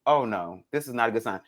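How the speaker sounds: ordinary talk, nothing marked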